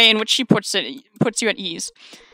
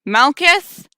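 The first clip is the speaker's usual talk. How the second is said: raised, higher questioning call; louder call; 5 semitones higher, 7 dB louder than usual. Acted. Delivery worker calling into silent woods, louder and higher. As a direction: brace yourself and call out louder. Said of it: "Say it louder".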